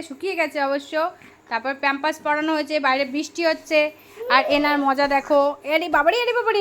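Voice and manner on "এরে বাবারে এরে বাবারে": playful exclamation. Real, not acted. Mother delights in baby's antics, playful high exclamation. Playing with lively baby.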